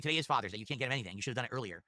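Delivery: ordinary talk, nothing marked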